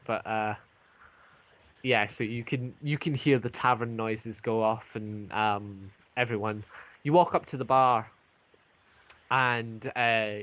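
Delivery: normal talking voice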